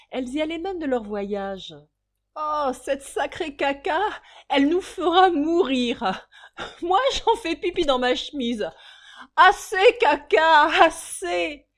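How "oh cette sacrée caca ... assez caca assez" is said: voix de femme, haute et forte, en riant; hilare. Acted. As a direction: crie de rire à la môme. Les femmes hilares s'écrient. (rires criés, très haut)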